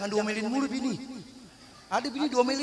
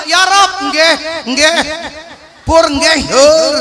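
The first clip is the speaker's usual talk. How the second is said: mock Javanese prayer; 4 semitones higher, 12 dB louder, much slower than usual. Javanese praying, mocked.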